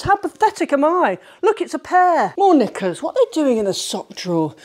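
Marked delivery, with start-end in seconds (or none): none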